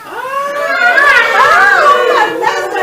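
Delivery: high, loud mock-terrified cry; mock scream. Disciples screaming at a ghost, mock terror. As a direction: scream it slowly in pretend panic. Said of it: "Empiezan a gritar los discípulos".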